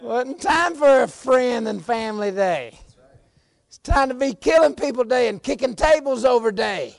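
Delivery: high, loud preacher's holler; full-force preaching. Real, not acted. Preacher contrasting Jesus' days to congregation, full-force shout. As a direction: belt it out to the whole church.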